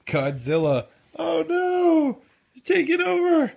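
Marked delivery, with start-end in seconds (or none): 1.2-3.5 excited. Real, not acted. Parent laughing over toddler mess.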